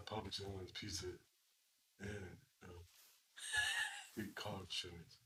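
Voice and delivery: quiet low Shaq impression.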